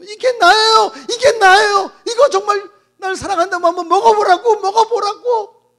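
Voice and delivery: dying woman screaming at the pastor; sobbing shout. Acted. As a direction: scream it through tears. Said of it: "소리소리 지르더래요".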